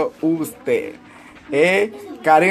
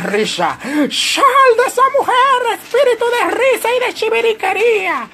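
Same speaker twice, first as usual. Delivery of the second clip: hammed-up preacher casting out laughter; mock exorcist preacher. Preacher shrieking at a laughing woman to expel the demon. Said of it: "pues entonces va a decir".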